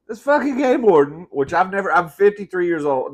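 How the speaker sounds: high, loud indignant burst; exasperated outburst. Real, not acted. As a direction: spit out the name in outrage. Ranting about the warden.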